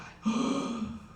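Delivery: high, mock-horrified gasp; shocked gasp. Moviegoer fearing Luke is the villain, dramatic gasp. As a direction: gasp at a terrible plot twist.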